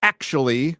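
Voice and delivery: mock know-it-all correction, loud and high at first; pedantic lore heads. Lore heads correcting a scholar on Twitter, mock pedantic. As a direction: cut in like a pedant. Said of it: "They will go".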